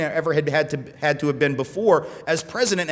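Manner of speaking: ordinary talk, nothing marked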